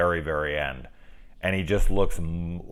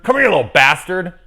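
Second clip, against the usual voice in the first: loud, higher, fast mock threat; angry villain line; 9 semitones higher, 9 dB louder, faster than usual. Shown as a demonstration. Suggesting a better line, mock angry. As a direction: snap it out like a villain. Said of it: "They could say bastard on T V".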